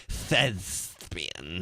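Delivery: thespian drawn out with a lisp. Shown as a demonstration. Insisting it needs a lisp, lisped thespian. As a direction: lisp thespian with a mock flourish.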